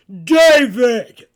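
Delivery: huge, loud giant's bellow from afar; booming call. Acted. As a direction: roar the name down the street. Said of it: "then someone called".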